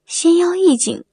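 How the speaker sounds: usual speaking voice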